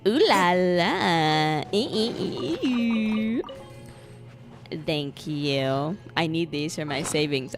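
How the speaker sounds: ordinary talk, nothing marked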